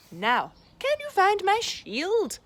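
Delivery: a light jolly dad voice; cheery dad. Acted.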